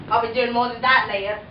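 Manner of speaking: low Cockney leer; lightly Cockney prince. Acted. The prince leering at Cinderella, Cockney accent. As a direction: leer at her like a London charmer.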